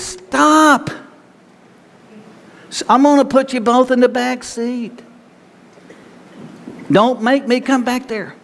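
put-on high, sharp stop like a parent scolding kids in the back seat; fed-up mom or dad turning round in the car; exasperated parent. Pastor to a bickering couple, as an exasperated parent.

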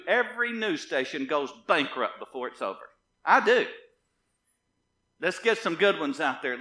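forceful, emphatic pulpit declaration; defiant preaching. Real, not acted. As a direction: declare it with authority, hitting the key words. Defiant preaching against news stations.

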